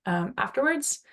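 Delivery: ordinary talk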